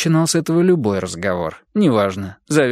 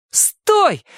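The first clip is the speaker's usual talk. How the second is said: very high shrill-pitched yell; shout. Acted. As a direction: scream it at him. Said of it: "закричала она". (крик)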